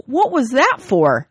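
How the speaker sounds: hammed-up puzzled wife; wife asks. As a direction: ask it puzzled in her high voice. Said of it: "Esther pulls away and asks him".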